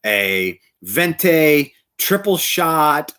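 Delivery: slow, fussy coffee order, each item stretched out; drawn-out fussy order. Retold or imitated. To the barista, mocking his own long order, slow and drawn out. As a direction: spell out the order painfully slowly, mocking yourself. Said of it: "took like a million years to say".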